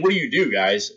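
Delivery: usual speaking voice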